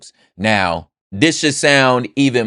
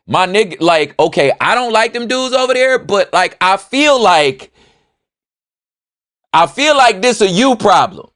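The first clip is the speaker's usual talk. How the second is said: exasperated refusal; 12 semitones higher, 5 dB louder than usual. Pretend. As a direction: stretch it out in disbelief, not my problem. Refusing friend's fight, comic exasperation.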